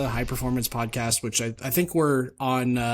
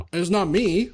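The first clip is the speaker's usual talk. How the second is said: higher-pitched mock bratty whine; entitled brat; 7 semitones higher, 4 dB louder than usual. Mimicking entitled driver dodging blame, bratty. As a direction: play up the spoiled denial. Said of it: "like, an entitled brat".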